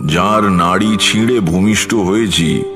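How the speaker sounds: ordinary talk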